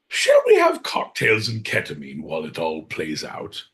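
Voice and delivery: ordinary delivery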